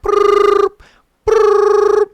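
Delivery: high buzzing ring noise; phone ringing. Retold or imitated.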